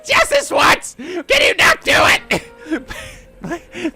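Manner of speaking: slow, high, whiny begging; pleading. Real, not acted. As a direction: drag out a desperate plea. Begging the witness.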